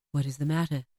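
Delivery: low, measured spirit voice; low-pitched, otherworldly question; ghostly spirit. A narrator's reading. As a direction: ask gently in a deep ghostly tone.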